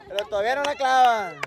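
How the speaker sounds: ordinary talk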